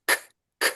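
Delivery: a hard K pushed from the back; k sound. Shown as a demonstration. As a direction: clip K off crisp. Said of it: "hit the K on speak".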